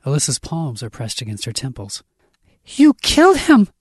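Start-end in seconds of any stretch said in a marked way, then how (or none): 2.6-3.7 high, loud screamed accusation; piercing, anguished yell; shout. A narrator's reading. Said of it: "I shout".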